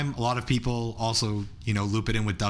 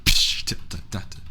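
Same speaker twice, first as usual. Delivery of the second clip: beatbox rhythm; 4 semitones lower than usual. Shown as a demonstration. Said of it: "beatboxing a rhythm".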